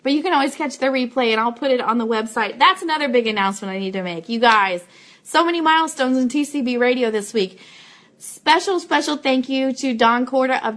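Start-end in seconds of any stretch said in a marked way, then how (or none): none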